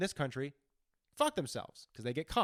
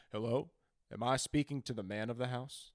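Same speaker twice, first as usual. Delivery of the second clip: softer, canned sales-call greeting; robocall voice; 4 semitones lower, 6 dB quieter than usual. Retold or imitated. A robocall greets the man of the house, canned and soft. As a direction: sound like a recorded dialer. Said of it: "and it goes".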